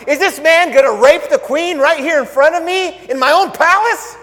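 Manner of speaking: high, loud outraged king; outraged cry. Acted. King loudly outraged at Haman on the couch. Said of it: "the king cries out".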